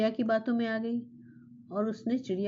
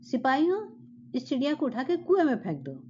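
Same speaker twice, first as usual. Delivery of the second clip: angry shout; 5 semitones higher than usual. Acted. Furious king shouts to guards to throw bird in well. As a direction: yell the order at the guards. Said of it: "वो फिर गुस्से से चिल्लाया".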